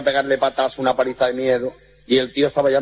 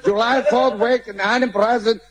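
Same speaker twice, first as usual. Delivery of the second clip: mock gringo English; 9 semitones higher, slower than usual. American garbled English.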